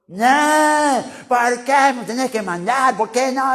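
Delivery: lightly voiced whiny kid talking back; a hint of a stubborn child protesting; mock child pushing back at parent; sulky child.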